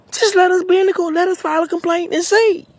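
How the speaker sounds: a cry for a hearing, voice shooting up; man begging the court to let him in, high and desperate; desperate high plea. Real, not acted.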